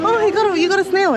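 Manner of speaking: excited. Real, not acted. Kid thrilled at snail.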